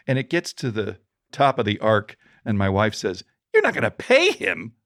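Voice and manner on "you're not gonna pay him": wife's warning. Retold or imitated. Wife voiced in retold story.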